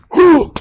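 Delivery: sharp high gasp of fake amazement; hammed-up gasp; mock gasp. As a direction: gasp as if shocked by the reveal. Mock gasp before reveal.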